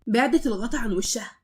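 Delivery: usual speaking voice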